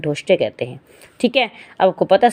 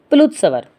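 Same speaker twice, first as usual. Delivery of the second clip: usual speaking voice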